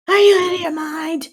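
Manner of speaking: hammed-up furious mom shouting up the stairs; mother yelling. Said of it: "all yelling up and down the stairs".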